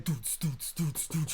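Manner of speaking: soft, quiet "doots, doots" mimicking thumping music; mock beat noise. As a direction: hum the bass beat of the room under your breath. Imitating the event's pumping music.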